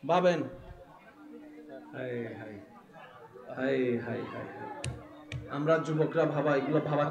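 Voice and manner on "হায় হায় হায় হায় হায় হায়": low, hushed sigh of grief; lamenting. Real, not acted. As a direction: lament softly, deep in the voice. Laments children killed in war. (নিচু গলায় হাহাকার)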